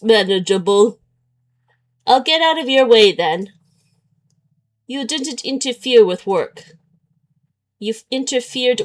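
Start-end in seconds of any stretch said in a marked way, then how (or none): none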